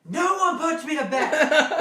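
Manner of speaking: high, slow, loud proclamation; drunken bellow. Retold or imitated. Drunk coming back downstairs to party, slow defiant bellow. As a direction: bellow it defiantly, dragging the words out.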